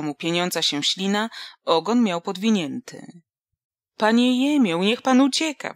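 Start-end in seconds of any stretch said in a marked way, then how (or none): 4.0-5.7 louder, higher yell of alarm; screamed warning. Acted. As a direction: shout at him to run, stress on pan. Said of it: "wrzasnął Wasyl".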